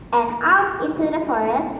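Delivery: plain reading voice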